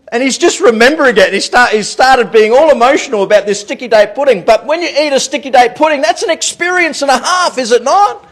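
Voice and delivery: animated preaching. Real, not acted. Preacher relishing sticky date pudding with church, animated preaching. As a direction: build it up to a rhetorical question.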